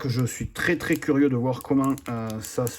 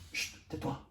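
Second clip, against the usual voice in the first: hushing whisper; 12 dB quieter than usual. Real, not acted. Hushing the cat.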